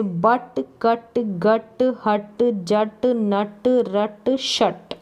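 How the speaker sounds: कट, गट, हट, जट each said very slowly with a short अ; slow drill. Shown as a demonstration. Teacher reading rhyming words slowly for beginners. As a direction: stretch out each word for the class. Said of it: "इसको अट करके पढ़ेंगे".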